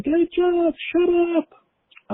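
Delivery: very high squeaky cat voice. Pretend. Voicing her cat meowing at her, very high squeak. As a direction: squeak it like a cat talking back. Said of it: "She's saying".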